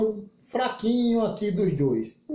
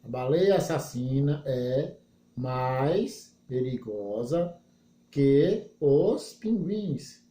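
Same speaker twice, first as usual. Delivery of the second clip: very slow, word by word, lower; slow literal reading; 7 semitones lower, much slower than usual. Shown as a demonstration. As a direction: spell out the sentence slowly as a model translation. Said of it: "ela tem uma literalidade, ó".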